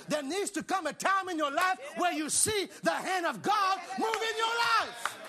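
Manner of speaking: high, impassioned pulpit shout; fired-up preacher crying out; full-force preaching. Real, not acted. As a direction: build to a roaring climax for the congregation.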